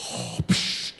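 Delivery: Darth Vader respirator breath; hollow inhale, hissing exhale; mechanical breathing. Acted. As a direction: imitate a masked villain's breathing. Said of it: "that's, like, a breathing mechanism".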